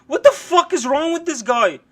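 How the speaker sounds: usual speaking voice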